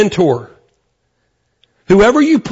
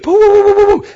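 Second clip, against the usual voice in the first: high-pitched gushing babble mimicking her; excited babbling; 12 semitones higher than usual. Pastor mimics an excited churchgoer's chatter, high babbling. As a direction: rattle off boo boo boo like an excited chatterbox. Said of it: "I mean, she was excited".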